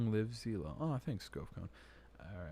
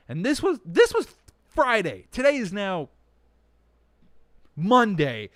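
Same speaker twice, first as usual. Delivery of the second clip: loud, much higher, indignant venting; outraged rant; 8 semitones higher, 12 dB louder than usual. Real, not acted. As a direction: blow up about being charged. Ranting about stolen money.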